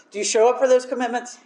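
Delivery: quick, pointed question; brisk questioning. Retold or imitated. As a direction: push the question fast. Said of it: "sponsor questioning".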